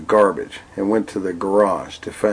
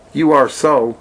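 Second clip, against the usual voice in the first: talking doll; 5 semitones higher, 4 dB louder than usual. Acted. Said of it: "said the doll".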